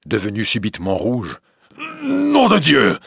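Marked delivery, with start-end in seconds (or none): 2.0-3.0 high, loud furious oath; stammering oath. Acted. Husband realising the betrayal, furious high oath. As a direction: spit the oath out in shock. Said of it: "bégaya-t-il".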